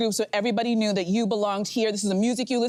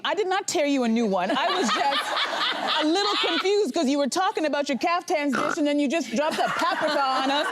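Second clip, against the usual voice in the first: usual speaking voice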